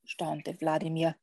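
measured read-aloud with long pauses; lively picture-book narrator, pausing between phrases; story narration. A narrator's reading. Podcast guest reads a vampire picture book to listeners, storytelling.

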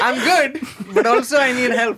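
high-pitched teasing through laughter; laughing mock reply. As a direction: play his muddled answer for laughs. Daughter mocks his contradictory answer.

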